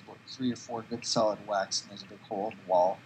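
ordinary delivery

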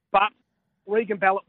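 ordinary talk, nothing marked

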